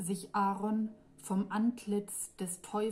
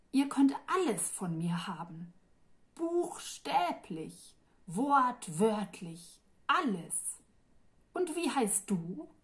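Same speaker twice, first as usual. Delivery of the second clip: lightly voiced demon; 2 semitones higher than usual. A narrator's reading. Demon lists his offers to Kim, lightly voiced. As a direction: tempt her with everything, a little grand.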